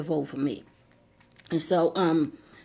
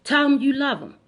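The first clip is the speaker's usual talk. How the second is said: retold yell; 6 semitones higher than usual. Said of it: "a voice yelled to me so loud".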